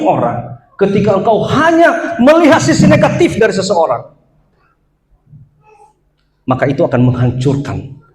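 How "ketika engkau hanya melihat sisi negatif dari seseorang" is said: raised, shouted warning; preaching shout. Real, not acted. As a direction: cry it out to the congregation. (teriakan khotbah yang keras)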